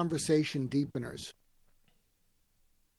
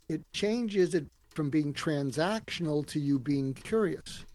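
normal talking voice

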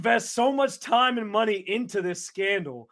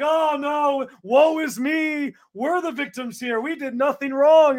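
higher, hammed-up woe-is-me whining; mock Michigan playing the victim, woe is me drawn out slow; sarcastic self-pity, pitched up; mock victim whine; 6 semitones higher, 3 dB louder, slower than usual. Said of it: "play the victim game".

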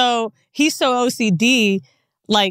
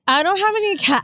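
high flustered voice; 8 semitones higher than usual. Retold or imitated. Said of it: "I sounded so".

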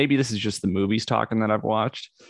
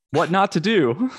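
mock disbelief, pitched up; incredulous; 9 semitones higher, 4 dB louder, slower than usual. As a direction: sneer it like a baffled question. Mocking the cartoon course.

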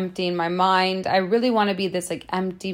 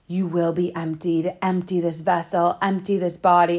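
dark entity voice. Retold or imitated. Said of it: "talking like this".

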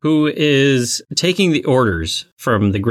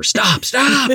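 put-on high pleading kid; 11 semitones higher than usual. Acting out kid in fight.

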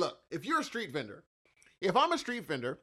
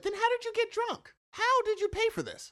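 very high-pitched, squeaky disbelief; mock-outraged high-pitched questioning; incredulous. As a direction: challenge a lame excuse in a far higher voice. Acted challenge to drunk customer.

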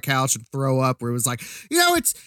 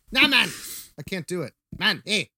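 put-on South African accent; 4 semitones higher than usual. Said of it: "Pick him up with your hands".

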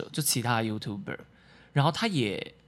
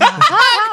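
loud, high burst of laughter; real high-pitched guffaw; 15 dB louder than usual. Real, not acted. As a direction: crack up suddenly at a tease. Bursts out at host's tease.

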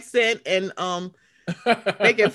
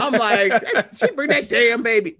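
laughing; 6 dB louder than usual. Real, not acted.

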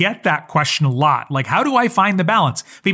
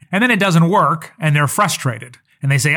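normal talking voice

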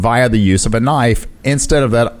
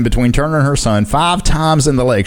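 ordinary talk, nothing marked

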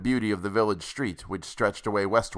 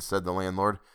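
ordinary delivery

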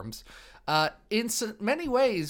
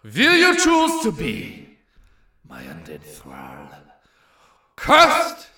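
swooping slow vampire, shouted end; Dracula voice; 7 dB louder, much slower than usual. Acted. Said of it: "I, Dracula".

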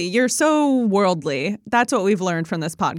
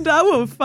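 dog bark; 6 semitones higher than usual. Retold or imitated. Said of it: "every time I see an Italian Greyhound".

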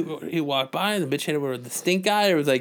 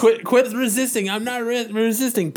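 high, loud slow yelling cop; mock cop arrest; 10 semitones higher, 5 dB louder than usual. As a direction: bark it like a flustered cop. Acting cop and donut scene.

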